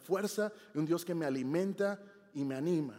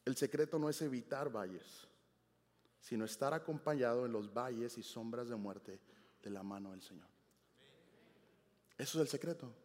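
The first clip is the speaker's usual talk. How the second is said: hushed; 5 semitones lower, 7 dB quieter than usual. Real, not acted. Preacher draws the lesson.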